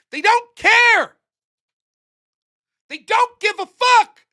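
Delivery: slow, loud, high furious shout. Real, not acted. Ranting comedian yells at the crowd, furious. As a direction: yell it at the crowd, slowing down. Angry political rant escalates.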